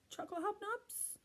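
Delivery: very high, hopeful little squeak; tiny child. A narrator's reading. Tiny kid on another child asking for biscuits, squeaky.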